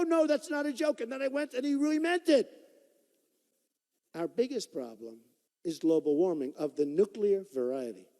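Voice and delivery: ordinary delivery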